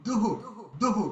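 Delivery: "দুহু" with a breath of h echoing the u; visarga echo. Shown as a demonstration. Teacher sounding out the visarga, "দুহু" with echoed vowel. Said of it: "তারপরে দীর্ঘ".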